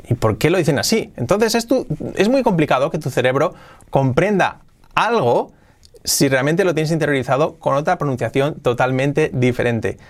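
normal talking voice

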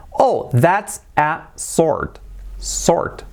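slow, spaced words, "sword" as "sord"; correct sword. Shown as a demonstration. Teacher modelling a sentence for learners, silent-w sword. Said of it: "Así se dice".

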